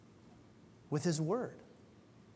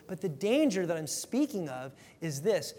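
ordinary delivery

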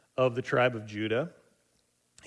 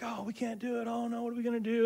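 whining crowd; 11 semitones higher, 5 dB quieter, faster than usual. Retold or imitated. People complaining, voiced by preacher.

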